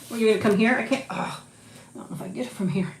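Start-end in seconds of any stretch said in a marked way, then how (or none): none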